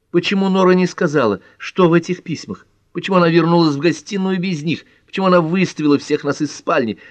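high-pitched, worried string of questions; anxious questioning. A narrator's reading. Pat frets to Ellery over Nora's letters, high and anxious.

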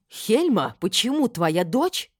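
loud, high indignant demand; вскипевшая женщина. A narrator's reading. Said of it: "вскипела мама". (возмущённо, громко и высоко)

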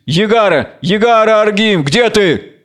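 high-pitched call for help; crying out. A narrator's reading. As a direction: call out for the missing jailer. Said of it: "Кричит".

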